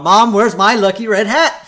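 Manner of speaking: put-on high, loud, demanding kid; Bart. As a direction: holler for Mom's help. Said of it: "And Bart".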